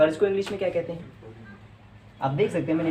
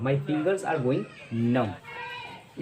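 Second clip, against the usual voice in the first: very slow, numb dropped low and soft; slow, spaced-out dictation; teacher's model sentence; 3 semitones lower, 5 dB quieter, much slower than usual. Shown as a demonstration. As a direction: model it slowly, pause before numb. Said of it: "इसको नम पढ़ा जाता है".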